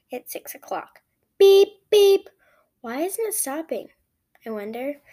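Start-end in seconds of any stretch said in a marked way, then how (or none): 1.4-2.3 high loud electronic beep; watch beeping. A narrator's reading.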